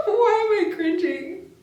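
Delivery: embarrassed laughter; laughing. Real, not acted. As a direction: giggle helplessly through it. Laughing while reading spicy lines.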